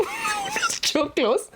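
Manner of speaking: drawn-out high laughing echo, slow; amused mock echo, stretched way up. Real, not acted. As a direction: repeat the word through real laughter. Laughing echo of friend's word.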